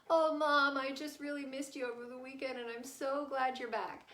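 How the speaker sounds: put-on high, gushing child voice, loud at first; heartfelt child. Child tells mom she missed her, imagined gushing. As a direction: imitate a kid pouring out affection.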